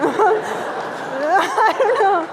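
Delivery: slow words pushed out through loud laughter; laughing. Real, not acted. Student sharing her peaceful feeling, laughing loudly.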